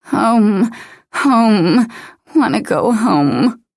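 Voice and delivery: drowsy, slurred pleading. A narrator's reading. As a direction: slur the words, high and slow. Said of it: "the words slurred".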